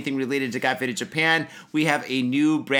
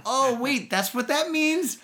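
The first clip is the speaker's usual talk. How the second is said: mock surprise; 9 semitones higher, slower than usual. Retold realization about lyric.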